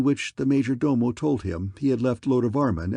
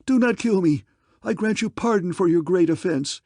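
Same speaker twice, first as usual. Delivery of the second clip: terrified pleading; 7 semitones higher, 4 dB louder than usual. Acted. King pleading for life.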